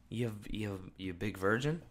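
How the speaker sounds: soft, low teasing insult under the breath; muttered insult. Retold or imitated. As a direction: mutter it almost to yourself.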